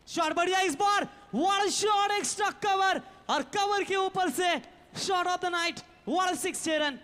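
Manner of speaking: loud, high shouting over a big six; excited cricket commentary. Real, not acted. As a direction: yell it out as the ball clears the rope. Six hit, live commentary.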